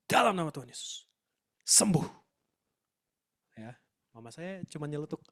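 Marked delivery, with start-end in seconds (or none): 0.1-2.0 loud, forceful command to be healed; loud healing prayer. Retold or imitated. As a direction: re-enact a commanding prayer, punching each phrase. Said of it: "suaranya lebih kenceng".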